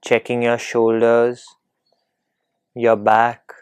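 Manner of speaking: usual speaking voice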